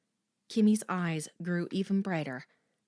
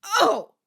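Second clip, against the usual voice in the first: pained groan; 14 semitones higher, 12 dB louder than usual. Acted. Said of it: "Kimmy groaned".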